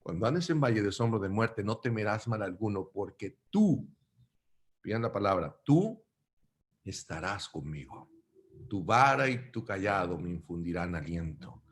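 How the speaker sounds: soft, reverent psalm recital; scripture reading. Acted. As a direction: read the psalm gently to the congregation. Reads Psalm 23 aloud.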